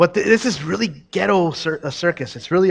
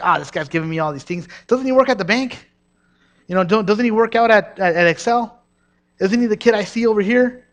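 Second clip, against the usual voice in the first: higher, louder sneering gossip voice; mocking doubter; 5 semitones higher, 4 dB louder than usual. Preacher mocking congregants who doubt a young prophet, sneering. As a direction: scoff like a skeptical churchgoer.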